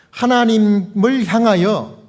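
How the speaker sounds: usual speaking voice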